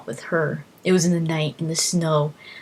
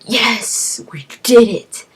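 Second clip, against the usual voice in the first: higher and much louder victory shout; triumphant cheer; 5 semitones higher, 7 dB louder, much slower than usual. Retold or imitated. Gamer celebrating the dragon kill, loud cheer. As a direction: cheer like you just won the fight. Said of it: "And I was like".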